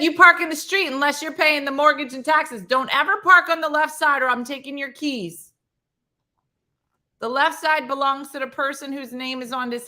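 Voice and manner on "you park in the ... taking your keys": a hint of a stern grandma. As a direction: lay down the house rule. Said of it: "She said".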